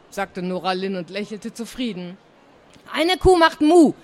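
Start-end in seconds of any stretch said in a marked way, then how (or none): 2.9-3.9 high, loud gleeful shout; cheeky raised voice; called out. A narrator's reading. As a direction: blurt out a silly rhyme to the class. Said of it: "rief Frieda".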